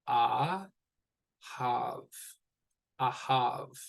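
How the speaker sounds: slow teacher breakdown of "ahav", with pauses; syllable by syllable, then joined. Shown as a demonstration. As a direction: sound out "a", then "have", then join as ahav. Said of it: "when we're pronouncing that correctly".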